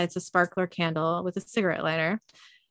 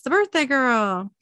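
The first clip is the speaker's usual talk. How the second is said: higher, louder mock cheer, sliding down; party call-out; 6 semitones higher, 5 dB louder than usual. Woman at a birthday party hailing the guest, mildly cheering. Partygoer calls out in retold scene.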